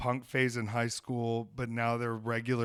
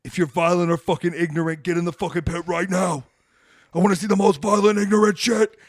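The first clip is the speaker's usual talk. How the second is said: loud, higher, rough shout at the crowd; hardcore frontman yell; 5 semitones higher, 10 dB louder than usual. Retold or imitated. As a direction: yell it like a singer calling for a mosh pit. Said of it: "Lead singer's like".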